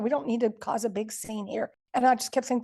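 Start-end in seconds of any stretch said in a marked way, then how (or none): none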